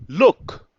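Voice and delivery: लुक shouted with a sharp rise; stressed look. Shown as a demonstration. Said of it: "stress देके, जोर देके बोल रहा है".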